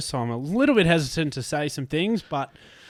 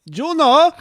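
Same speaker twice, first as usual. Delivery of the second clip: excited shout; 14 semitones higher, 13 dB louder than usual. Real, not acted. Excited cry of guest band name.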